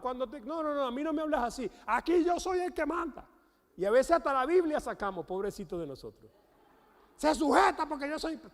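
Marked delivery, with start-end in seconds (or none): 0.4-3.3 high, raised-pitch mock scolding; domineering protest. Husband refusing to be talked to that way, acted indignation. 7.1-8.4 high, loud bossy demand; mock domineering order. Husband using the Bible against his wife, mock commanding shout.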